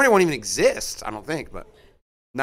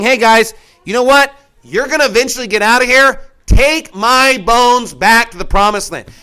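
louder, higher confident charge; rally the family with a sure promise; quoting Joseph with conviction; 4 semitones higher, 6 dB louder than usual. Retold or imitated. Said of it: "He's saying".

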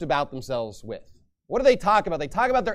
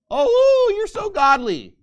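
mocking; 13 semitones higher, 5 dB louder, slower than usual. Mocking the Pharisees' piety.

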